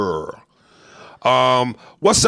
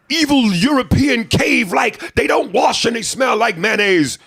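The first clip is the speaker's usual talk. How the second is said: shouted, much higher ranting sermon voice; ranting street preacher; 13 semitones higher, 6 dB louder than usual. Retold or imitated. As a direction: yell it like a furious street preacher. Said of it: "with such passion".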